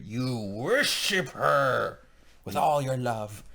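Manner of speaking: slow, drawn-out mock decree; hammily stretched words of devotion; mock commandment. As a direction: lay down the law with comic weight. Mock lecture on marriage.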